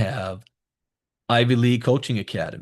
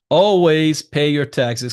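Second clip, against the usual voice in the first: "Always pay your taxes" slow and louder, Always punched; emphatic; 6 dB louder, slower than usual. Shown as a demonstration. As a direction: hammer home the rule. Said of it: "This is important".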